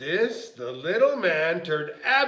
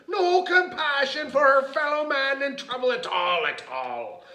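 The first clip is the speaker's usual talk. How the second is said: hammed-up leprechaun outrage trailing down; mock scream, fading; 7 semitones higher, 4 dB louder than usual. A narrator's reading. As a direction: wail indignantly, then let it sink. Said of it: "screamed the little man".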